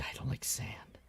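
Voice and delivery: soft unvoiced whisper of the line; whispered quote. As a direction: breathe it out as a mock-secret. Quoting Anakin's sand line.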